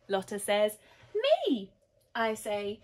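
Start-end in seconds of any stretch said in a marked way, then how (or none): 1.1-1.7 put-on high, loud child's pipe-up; eager volunteering. A narrator's reading. Lotta offers herself too, piping up eagerly.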